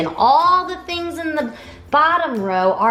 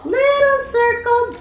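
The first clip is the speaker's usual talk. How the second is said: little voice; 11 semitones higher than usual. Shown as a demonstration. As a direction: squeak it small and high. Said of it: "say hi with a little voice".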